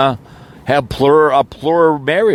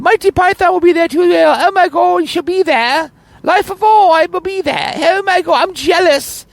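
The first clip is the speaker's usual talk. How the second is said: very high falsetto, gushing over the wedding; mock squeaky fangirl. Mocking fan's excited gushing.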